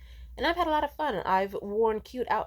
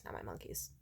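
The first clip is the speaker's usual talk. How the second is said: dismissive murmur; 13 dB quieter than usual. Real, not acted. Dismissive trailing end of list.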